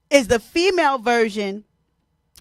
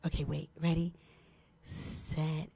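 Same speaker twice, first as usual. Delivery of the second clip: whispering; 9 semitones lower, 18 dB quieter than usual. Real, not acted. Host prepping phone quietly.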